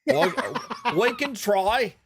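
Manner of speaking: high-pitched, laughing stab at British; attempted British accent. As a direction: try on a shaky mock-British accent. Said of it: "do it in a British accent".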